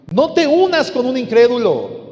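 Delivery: loud, high preacher's command; forceful warning. Real, not acted. Preacher warns against yoking with unbelievers, forceful warning. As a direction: ring it out over the congregation.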